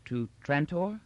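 high, hesitant rising question; blank, uncomprehending. A narrator's reading. Old Emperor asked to sign an order, blankly puzzled. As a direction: echo it as if it means nothing to you. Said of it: "blank and uncomprehending".

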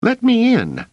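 high, loud squeaky demand; very high boyish yell; excited shout. A narrator's reading. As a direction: shout to be let in, urgently. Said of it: "he shouted".

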